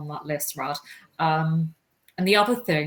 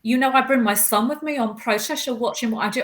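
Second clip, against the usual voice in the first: high-pitched indignant disbelief; stunned and affronted; taken aback; 7 semitones higher, 4 dB louder than usual. Retold or imitated. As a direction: retell the shock, voice raised in pitch. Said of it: "I was so taken aback".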